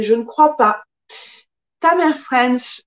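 ordinary talk, nothing marked

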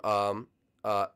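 ordinary talk, nothing marked

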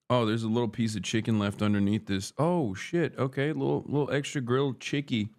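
ordinary talk

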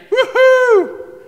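very high, loud mock whoop; woohoo cheer. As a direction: whoop like a bragger celebrating. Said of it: "Or you might say".

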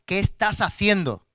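slow, loud stern questioning; serious reproof. Retold or imitated. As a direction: say it weightily, each word loud and drawn out. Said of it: "me dijo las cosas serias".

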